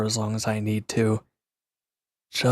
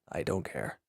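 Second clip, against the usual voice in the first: muttered low and much softer, sulky; 6 semitones lower, 11 dB quieter than usual. Acted. Brushing off the question about food, muttered sulkily. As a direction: mumble it away under your breath.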